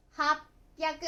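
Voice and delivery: 八百 read "hap-pyaku", stopped before the p; happyaku. Shown as a demonstration. Teacher showing the same change in 八百, happyaku with a held stop. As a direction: cut 八 to "hap", then "pyaku". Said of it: "これも同じですね".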